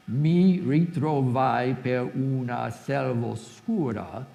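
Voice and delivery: slow, syllable-by-syllable Italian line; Italian recitation. Shown as a demonstration. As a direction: count out each syllable in Italian. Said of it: "let's test it".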